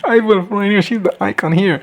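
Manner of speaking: high, excited crowd cheer; cheering fan. Acted. Crowd hailing Rhaenyra as their queen, cheering.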